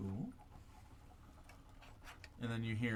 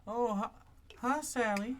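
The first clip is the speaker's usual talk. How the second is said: high, bright hello; greeting a neighbour; 12 semitones higher, 5 dB louder than usual. Acted. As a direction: chirp a friendly hi to the neighbour. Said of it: "they say, like".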